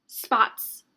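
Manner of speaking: "Spots" in one quick, loud burst; said fast. Shown as a demonstration. Teacher showing what is hard to hear, said fast. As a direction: rattle off "Spots" as a single fast word. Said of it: "I wasn't saying it fast".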